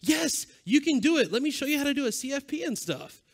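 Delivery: high, eager encouragement; upbeat coaching of a newcomer; encouraging. Pretend. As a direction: cheer them toward speaking.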